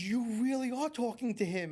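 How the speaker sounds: lightly voiced girl realizing it's real; amazed. Girl tells the psychic he really hears her father, mildly awed.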